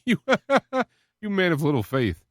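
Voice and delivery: chuckling fondly through the words; laughing Jesus. Retold or imitated. Jesus teasing Peter after pulling him from the water, laughing. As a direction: laugh as you gently tease. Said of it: "I could see him laughing".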